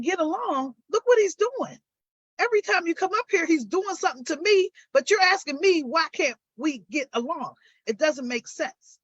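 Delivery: retelling her angry reply to the officer, high and heated; indignant retort. Retold or imitated. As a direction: fire it back at the officer in disbelief. Retold confrontation with officer.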